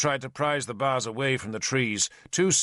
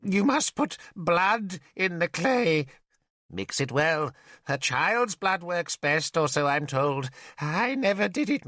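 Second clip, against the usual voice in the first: babbling mad Merlin; 7 semitones higher than usual. Acted. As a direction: ramble like a madman sharing secrets. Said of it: "He babbled on".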